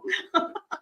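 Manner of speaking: soft, high giggle; short burst of quiet laughter; amused chuckle. Real, not acted. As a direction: laugh softly at a silly mental picture. Amused by her mental picture.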